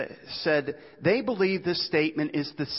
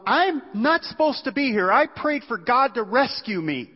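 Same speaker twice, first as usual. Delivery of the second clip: high-pitched indignant protest; acted-out upset objection; angry complaint; 9 semitones higher, 4 dB louder than usual. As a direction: protest as if wrongly treated, voice pitched up. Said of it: "He's pretty angry about it".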